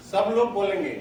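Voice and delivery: ordinary talk, nothing marked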